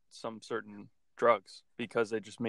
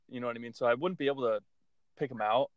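usual speaking voice

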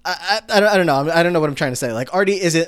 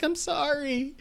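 much higher, small and sheepish; a hint of a guilty girl squeaking out sorry; mock meek apology; 7 dB quieter, much slower than usual. Mocking the girl apologizing to Artie, meek and squeaky. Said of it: "And she's like".

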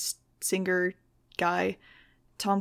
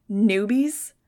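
loud, rising incredulous cry; exclaimed; 8 dB louder than usual. Acted. Max outraged at Fang calling Dylan a newbie, loud exclaim. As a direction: throw the word back in disbelief. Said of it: "I exclaimed".

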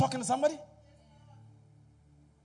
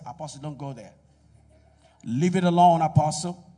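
mock congregant plea; 5 semitones lower, 9 dB louder than usual. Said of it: "somebody just say".

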